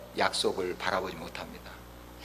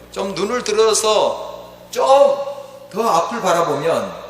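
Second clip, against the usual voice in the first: raised, emphatic sermon delivery; full-force preaching; 9 semitones higher, 8 dB louder than usual. Real, not acted. Preacher urging the congregation to see God, full-force preaching. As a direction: press each point home to the congregation.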